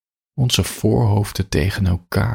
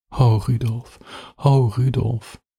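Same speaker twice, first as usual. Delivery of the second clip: moaned name; 5 semitones higher, slower than usual. Acted. Lovers' cries during sex, acted.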